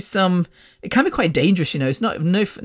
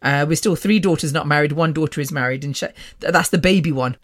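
normal talking voice

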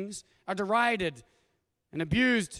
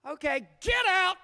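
much higher, slow, mock-brisk wife dropping kids off; 11 semitones higher, 5 dB louder than usual. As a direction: play the no-nonsense wife, a bit hammed-up. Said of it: "Lauren's like".

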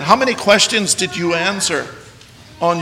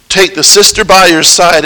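usual speaking voice

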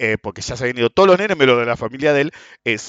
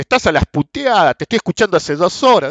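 louder, higher mock accusation; accusing yell; 6 semitones higher, 6 dB louder than usual. The man accusing him of swearing, loud mock complaint. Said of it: "entonces dice".

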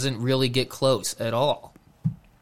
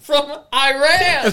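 laughing; 12 semitones higher, 4 dB louder, slower than usual. Real, not acted. High slow laughing correction.

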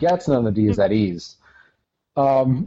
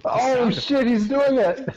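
excited cheering; 11 semitones higher, slower than usual. Real, not acted. Cheering a friend drinking two bottles.

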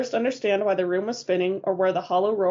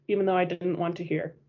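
plain reading voice

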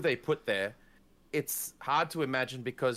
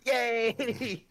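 high, mock-childish cheer; cheering kids; 10 semitones higher, 3 dB louder than usual. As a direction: cheer like a delighted kid. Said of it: "But kids were like".

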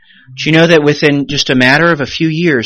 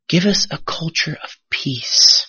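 softer and drawn out; slow, hushed repetition; 3 semitones higher, 4 dB quieter than usual. Shown as a demonstration. Pastor repeats a prayer for the congregation, slow and reverent. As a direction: let the prayer line sink in, slowly.

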